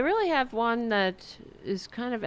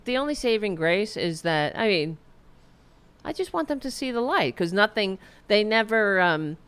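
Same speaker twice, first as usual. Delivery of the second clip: usual speaking voice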